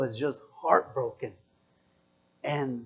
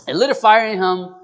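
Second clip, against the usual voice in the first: loud, higher preacher's repeat; punchy, raised sermon voice; sermon emphasis; 4 semitones higher, 10 dB louder than usual. Real, not acted. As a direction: drive it home like a preacher. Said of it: "This is gonna be, like, a sermon".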